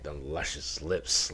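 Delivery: slow, hushed imitation of savoring the words; mock reminiscing. Viewer mimicking the narrator's relish, mock reminiscing. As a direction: imitate him lingering softly and low, lost in memory. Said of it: "he was reminiscing when he said".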